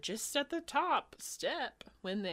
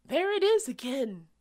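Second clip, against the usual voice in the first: gasping; 5 semitones higher, 8 dB louder, faster than usual. Acted. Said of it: "gasped Amy".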